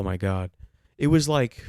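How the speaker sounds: usual speaking voice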